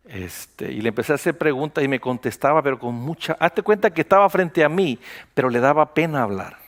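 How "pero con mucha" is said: normal talking voice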